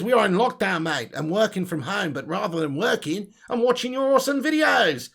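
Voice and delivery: reading aloud in mock Australian; jokey Australian twang, rising high at the end; bad Aussie accent. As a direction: read it like a hammed-up Aussie. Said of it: "shit Aussie accent".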